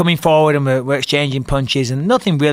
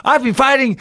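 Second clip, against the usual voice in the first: excited victory shout; 10 semitones higher than usual. Retold or imitated. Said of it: "I said the most crude comment ever".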